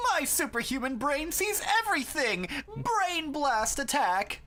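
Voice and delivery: very high cartoon attack call; hammy battle cry. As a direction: declare a superpower theatrically, drawing it out. Reads note as character's battle cry.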